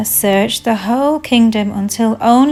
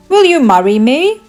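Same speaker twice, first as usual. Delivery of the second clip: higher, louder hopeful question; eager proposal; 5 semitones higher, 7 dB louder than usual. Acted. Prince asking Cinderella to marry him, eagerly. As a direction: ask with a big rising lilt.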